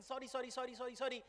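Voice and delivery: hushed, hurried apologizing; profuse apology. Acted. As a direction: apologize repeatedly, meek and flustered. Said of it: "starts bowing and saying".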